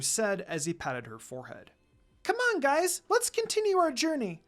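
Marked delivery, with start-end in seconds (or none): 2.2-4.4 put-on much higher, eager child voice; little fox urging everyone onward, excited; excited exclaim. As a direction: bounce it out, keen to go. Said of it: "Shippo exclaimed excitedly".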